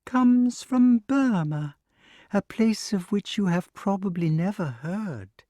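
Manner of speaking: sing-song voice. Acted. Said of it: "in its sing-song voice".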